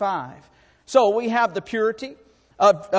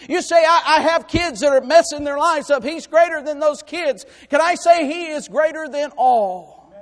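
loud, higher preaching climax; preaching at full force; 6 semitones higher, 7 dB louder than usual. Acted. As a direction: lift the congregation, building louder. Preaching climax, listing hardships.

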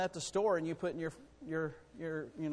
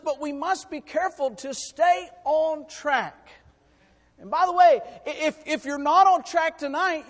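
raised, louder preaching voice; fervent pulpit delivery; 7 semitones higher, 7 dB louder than usual. Acted. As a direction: preach it out to the congregation. Preacher raising voice in sermon.

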